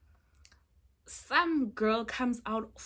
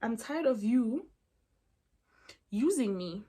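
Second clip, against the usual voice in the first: slow, deliberate retold ultimatum; imitating someone fed up and in earnest; dead serious; slower than usual. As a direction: spell it out slowly, with a heavy pause. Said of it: "she's literally dead ass, like".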